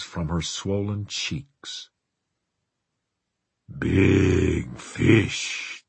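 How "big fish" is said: lower, gruff and greedy; guttural greedy voice. Acted. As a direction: growl it with hunger. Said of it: "cried in a guttural, greedy voice".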